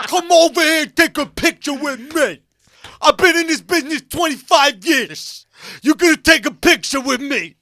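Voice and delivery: loud laughing Tracy Morgan bullying his way into a photo; Tracy Morgan impression. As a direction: yell him over like Tracy Morgan. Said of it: "horrible Tracy Morgan impression".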